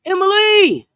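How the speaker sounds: high, loud, drawn-out name shout; excited call-out. Real, not acted. Host greeting a viewer in chat, excited loud call-out.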